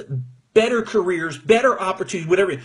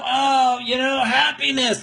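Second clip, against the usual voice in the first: mocking sappy voice; 6 semitones higher than usual.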